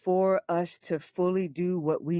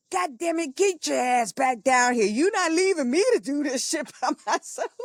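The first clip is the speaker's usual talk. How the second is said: high, exasperated, laughing scold; scolding sister; 8 semitones higher than usual. Retold or imitated. Sister refusing to be left alone, high laughing scold. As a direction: nag her back down with a laugh. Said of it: "she's like".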